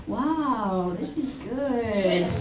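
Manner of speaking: impressed character. Acted. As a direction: savour it slowly and softly. Tamaki tastes pie, is impressed.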